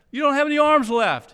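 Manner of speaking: an exasperated king shouting at the armless knight. Retold or imitated. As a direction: yell it at the stubborn knight. Arthur points out missing arms.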